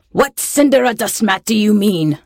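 a harsh storybook yell, not at full volume. A narrator's reading. Pirate captain confronts the flower thief, yelling. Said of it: "yelled Tomb, in a voice like a railway accident".

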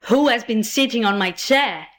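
ordinary delivery

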